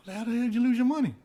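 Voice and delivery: high, fast exasperated question; incredulous. Retold or imitated. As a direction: fire it off in disbelief. Retold disbelief at roommate.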